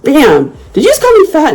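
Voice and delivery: punchy, high-pitched loud comeback; loud retort. Retold or imitated. Retelling her comeback at the post office, very loudly. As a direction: fire it off high for everyone to hear. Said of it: "I said very loudly".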